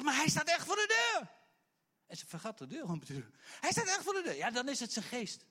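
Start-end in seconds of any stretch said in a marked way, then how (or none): 0.0-1.3 loud, high, exasperated insistence; insistent high cry. Retold or imitated. Rhoda insisting to the praying church, high and loud. 3.6-4.4 loud, high, exasperated insistence; insistent high cry. Retold or imitated. Rhoda insisting to the praying church, high and loud.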